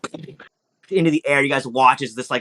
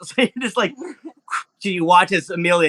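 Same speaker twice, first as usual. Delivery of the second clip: ordinary talk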